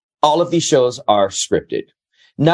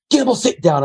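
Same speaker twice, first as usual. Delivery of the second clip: high, commanding mock producer; retold producer ordering him to sit; bossy producer voice; 11 semitones higher than usual.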